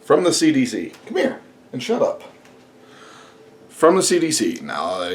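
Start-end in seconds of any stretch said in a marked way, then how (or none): 1.1-2.2 high scold at the dog; hushing the dog. Real, not acted. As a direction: shush the dog, high and quick.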